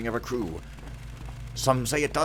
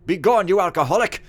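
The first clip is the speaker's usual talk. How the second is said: loud, high, theatrical dismissal; indignant shouted command; 10 semitones higher, 12 dB louder than usual. Acted. Steward sending off drunken servant, indignant shout. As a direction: banish him with a pompous yell.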